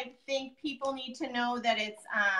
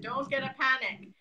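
lightly put-on Greek accent. Daughter repeating her Greek dad's saying, light Greek accent. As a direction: quote your dad with a hint of Greek accent. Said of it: "that Greek accent".

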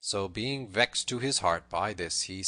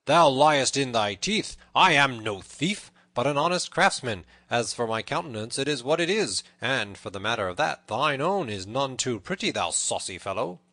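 loud, raised defiant voice; bold retort; 4 semitones higher, 8 dB louder than usual. A narrator's reading. Tanner denies being a thief, boldly defiant. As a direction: throw the insult straight back. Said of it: "quoth the tanner baldly".

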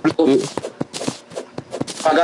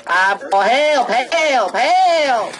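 high panicked cries for help; calling for help; 10 semitones higher, much slower than usual. Real, not acted. Player under attack shouts to teammates for help, high panicked cries. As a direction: yelp for help as the monster closes in.

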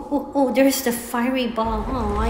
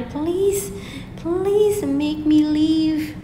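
drawn-out plea; 5 semitones higher, much slower than usual. Real, not acted. Begging the game to let her pass.

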